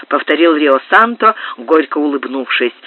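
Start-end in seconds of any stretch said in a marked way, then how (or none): none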